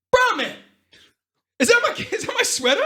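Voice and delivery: high, loud outraged squeal; hammed-up high furious shout; freaking out. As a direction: screech it in mock outrage. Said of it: "he freaking out at Bra Man".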